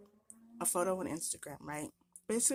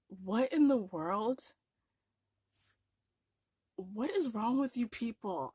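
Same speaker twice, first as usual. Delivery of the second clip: exasperated disbelief; 3 semitones higher, 4 dB louder, slower than usual. Retold or imitated. Quoting own baffled reaction.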